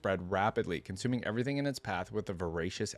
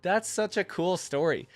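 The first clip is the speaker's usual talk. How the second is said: delighted; 8 semitones higher, 4 dB louder than usual. Real, not acted. Reacts after the story.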